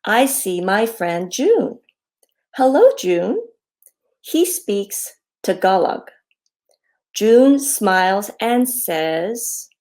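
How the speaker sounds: slow down and sing out each greeting; reading to children. A narrator's reading. Author reads her hello book to children, friendly read-aloud.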